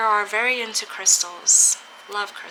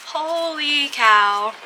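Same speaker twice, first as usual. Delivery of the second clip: slow, drawn-out stunned holy cow; higher and louder in real amazement; amazed exclaim; 6 semitones higher, 4 dB louder, much slower than usual. Real, not acted. Tarot reader seeing her fallen cards match the reading, stunned and amazed.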